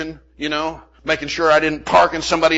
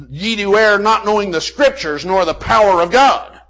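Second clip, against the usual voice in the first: preaching scripture; 5 semitones higher, 7 dB louder than usual. Acted. Quoting Jesus in sermon.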